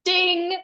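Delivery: high, ringing "ding"; imitated ding. Teacher recalling her intuition, an imitated high ding. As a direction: sound out a high bell-like ding. Imitating a sudden inner signal.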